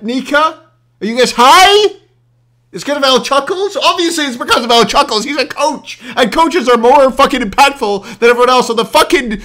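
loud, high, incredulous tirade; exasperated rant. Real, not acted. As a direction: shout it at clueless viewers in disbelief. Sarcastic rant answering chat.

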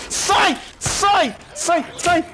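high, loud panicked yells; shouting get away. Real, not acted. Player swarmed by zombies yells at them. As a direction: shove them off with your voice.

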